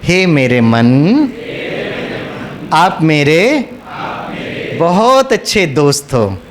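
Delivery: low, slow talk to the mind; loving, affectionate. Shown as a demonstration. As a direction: speak tenderly as to a dear friend, pausing between phrases. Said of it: "थोड़ा प्यार मोहब्बत को जोड़ो". (प्यार से, धीमे स्वर में)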